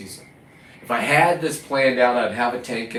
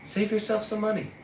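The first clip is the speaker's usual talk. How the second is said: higher and softer sarcastic voice; sarcastic put-on voice; 8 semitones higher, 6 dB quieter than usual. Real, not acted. Fisherman mocking store buyers in a higher, softer put-on voice. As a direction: sneer the advice in a put-on voice.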